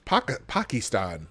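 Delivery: "Pakistan" with broad "ah" vowels; Obama-style pronunciation. Retold or imitated. Imitating Obama's sudden foreign pronunciation, "Pakistan" as PAH-kee-stahn. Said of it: "that Obama thing".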